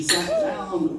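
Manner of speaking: mock dramatic high sit-down; high drawn-out. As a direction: voice swoops way up and slows on down. Comic stretched high sat down.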